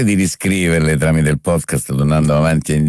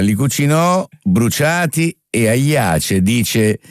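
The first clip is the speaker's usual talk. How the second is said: slow, carefully articulated reading; measured elocution drill; diction exercise recitation; 3 semitones higher, slower than usual. Shown as a demonstration. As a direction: recite it slowly, every vowel placed. Reads out a diction exercise sentence.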